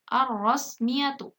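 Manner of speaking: slow "ar-rosmiyatu" with doubled rolled R; silent lam. Shown as a demonstration. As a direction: read "ar-rosmiyatu" deliberately, no L. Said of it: "Lamnya tidak dibaca jelas".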